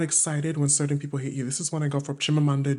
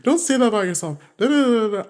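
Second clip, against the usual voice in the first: loud, high-pitched nagging mom; raised-voice African mom impression; scolding African mom; 7 semitones higher, 8 dB louder, faster than usual. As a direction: mimic a mother's scolding, trailing into nonsense syllables. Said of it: "My mom is African".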